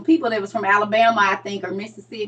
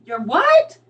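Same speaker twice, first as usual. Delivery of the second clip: higher, incredulous question; mock bafflement; 4 semitones higher, 4 dB louder than usual. Baffled listener to Saint Louis speaker, mock disbelief. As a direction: play baffled, pitch jumping up.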